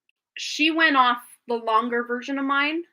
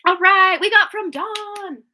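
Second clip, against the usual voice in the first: bright, loud, high greeting; excited; 8 semitones higher, 8 dB louder than usual. Real, not acted. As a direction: cheer as the donation lands.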